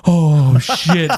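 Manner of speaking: drawn-out mock shock with a punch; half-amused dismay, imitated; shocked, half laughing. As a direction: act out an oh-no face. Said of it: "half shocked and half laughing".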